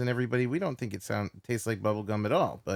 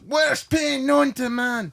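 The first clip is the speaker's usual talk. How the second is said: high, slow comic shout; screaming painometer; 13 semitones higher, 5 dB louder, much slower than usual. Acted. The painometer announcing its top reading, comic shout. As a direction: shout it drawn out like a cartoon alarm. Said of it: "Painometer goes".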